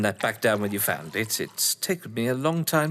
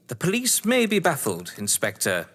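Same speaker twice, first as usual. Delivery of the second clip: ordinary talk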